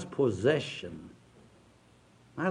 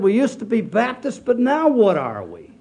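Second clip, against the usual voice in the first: put-on mild upset child; 6 semitones higher, 4 dB louder than usual. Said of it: "She was really upset".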